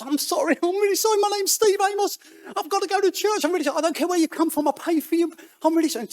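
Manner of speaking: very high, loud panicked babbling; frantic apology. Retold or imitated. Apologising to a screaming old lady in a taxi, frantic. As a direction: squeal it out in a desperate panic.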